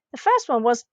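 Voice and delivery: ordinary talk, nothing marked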